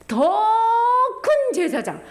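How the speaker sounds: loud stress on 더; emphasis. Real, not acted. Preacher stressing 더 큰 제사장, loud. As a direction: punch 더 loud and high. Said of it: "emphatic title".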